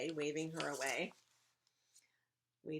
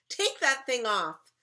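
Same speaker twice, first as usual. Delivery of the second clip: loud, high, slow command; exasperated big-kid bossiness; bossy demand; 7 semitones higher, 10 dB louder, slower than usual. Acted. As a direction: order it off with annoyance. Violet orders costume off.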